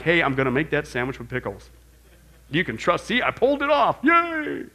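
mock Jesus with a dopey easy claim; mocking silly promise. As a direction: play it as a cheap party trick.

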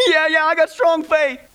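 high-pitched mock panic; acted desperate agreement. Dangling tourist swears to his faith, acted desperation. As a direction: insist frantically, pitched high, hammed up.

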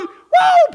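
very high, loud woo; joyful shouted woo; celebratory whoop. Acted. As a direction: let out a party whoop.

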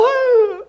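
high, hammed-up gasp; mock gasp. Preacher mimics shocked believers, hammed-up high gasp. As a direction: gasp as if scandalized.

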